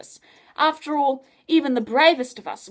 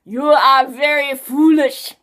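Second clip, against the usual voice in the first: slow, loud sneering villain voice; menacing villain; 4 semitones higher, 12 dB louder, slower than usual. Acted. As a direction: mad scientist talking down to the hero. Scientist threatening Adam.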